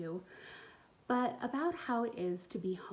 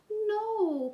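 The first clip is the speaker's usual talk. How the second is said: pleading cry; 13 semitones higher, 8 dB louder than usual. Acted. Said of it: "pleaded Hazel".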